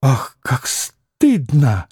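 slow, drawn-out dismay; sighing cry of shame; ashamed exclamation. Acted. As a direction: lament it slowly, aghast with shame. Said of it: "воскликнула Грушенька".

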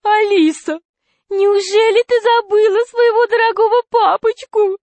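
high falsetto wheedling fat man; whiny, tearful voice. A narrator's reading. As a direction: plead plaintively in a thin, high whine. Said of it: "сказал толстяк плаксивым голосом".

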